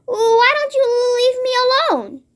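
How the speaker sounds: pleading. A narrator's reading. Nervous giant pleading.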